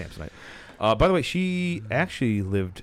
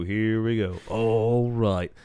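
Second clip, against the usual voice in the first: ordinary talk, nothing marked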